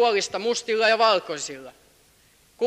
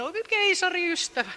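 mock voice; 10 semitones higher than usual. Mock voice, high.

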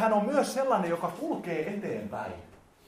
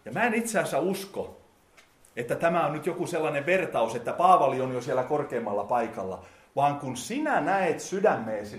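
ordinary delivery

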